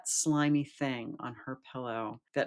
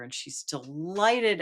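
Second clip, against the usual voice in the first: ordinary talk, nothing marked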